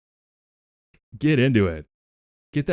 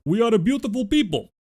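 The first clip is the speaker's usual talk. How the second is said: put-on Russian accent. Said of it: "let's do it in Russian".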